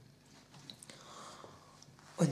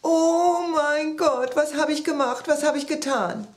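horrified wailing; 7 semitones higher, 5 dB louder than usual. A narrator's reading. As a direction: lament in acted panic and guilt. Character horrified by what happened. (entsetzt jammern)

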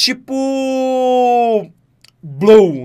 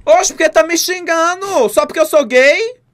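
mock indignation; 6 semitones higher than usual. Streamer playing wronged after being sworn at, mock indignant. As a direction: protest with exaggerated hurt, pitched up.

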